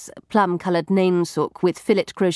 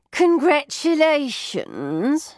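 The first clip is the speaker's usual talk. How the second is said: awkward housekeeper; 8 semitones higher, much slower than usual. A narrator's reading. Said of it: "said Missus Fitzsimmons".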